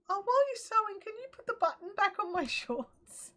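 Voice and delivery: high-pitched mock husband asking a favour; teasing impression, laugh creeping in at the end; imitated husband. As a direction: mimic a breezy request, pitched well up.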